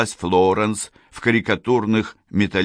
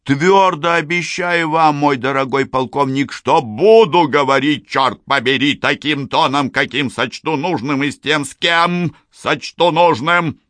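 defiant; 8 semitones higher, 5 dB louder than usual. A narrator's reading. Defiant retort to the colonel.

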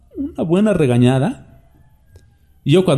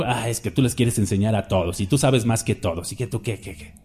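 softer, dismissive mock of his critics; 4 semitones lower, 7 dB quieter than usual. The preacher mimics critics who call him arrogant, softer and mocking. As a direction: wave off the accusation, quietly mocking. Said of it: "me han tachado de orgulloso".

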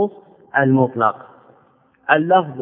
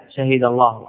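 usual speaking voice

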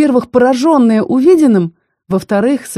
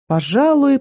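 ordinary delivery, nothing marked

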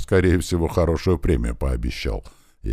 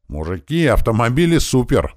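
high, loud, excited shout; yelled in passing; 8 semitones higher, 5 dB louder than usual. A narrator's reading. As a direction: shout it to the guys as you run by. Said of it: "проорал пробежавший мимо нас Корж". (восторженный громкий крик)

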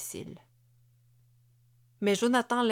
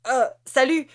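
sudden, louder, higher startled greeting; startled blurt; 6 semitones higher, 9 dB louder than usual. Acted. Husband caught by his wife, blurting a startled hello. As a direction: jolt out the hello as if caught red-handed. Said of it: "cracha-t-il dans la surprise".

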